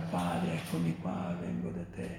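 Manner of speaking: very soft, almost whispered acted prayer; gentle, peaceful surrender. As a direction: murmur it as a serene last breath to the Father. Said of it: "è morto gridando e non".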